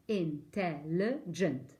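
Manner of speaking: in-tel-li-gent, each syllable spelt out slowly; teacher drawing out intelligent; slow syllable count. Shown as a demonstration. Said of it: "There are four syllables".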